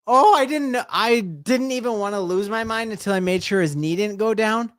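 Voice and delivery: high-pitched excited rush of relief; thrilled fan, voice lifted high; elated disbelief. Real, not acted. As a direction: burst out high, still amazed at the play. Reliving the touchdown, still elated.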